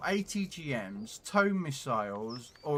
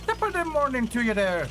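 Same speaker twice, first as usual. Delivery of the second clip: hammed-up Irish accent, much higher and louder; a cheery, overdone Irish greeting; playful stage Irish accent to the viewers; bad Irish accent; 9 semitones higher, 6 dB louder than usual. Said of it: "I do a really bad ac-, Irish impression".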